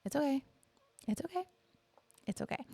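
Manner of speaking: high, fading whisper-soft it's okay; hushed self-soothing. Retold or imitated. Mother repeating to herself after teacher's remark, high and fading. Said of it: "I'm like".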